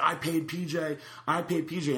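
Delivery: high-pitched mock protest; repeated plea, pitched well up; retold insistent protest. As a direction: imitate a man insisting he has paid. Retold protest in a money dispute.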